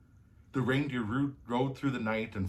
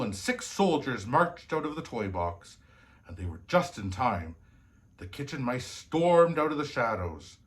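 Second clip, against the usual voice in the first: usual speaking voice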